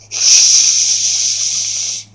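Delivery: loud unvoiced hiss. [name] hissing at the narrator, loud snake hiss. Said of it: "[name] is hissing at me".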